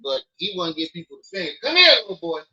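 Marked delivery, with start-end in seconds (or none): 1.6-2.4 parental summons. Real, not acted. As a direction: summon the little one, pitched up and loud. Calling his misbehaving son over.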